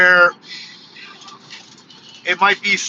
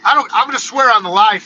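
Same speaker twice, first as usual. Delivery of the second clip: higher-pitched, stubborn insistence; real defiance, pitched up; defiant; 5 semitones higher than usual. Real, not acted. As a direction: dig in and refuse to apologise. Defiantly reverses own apology.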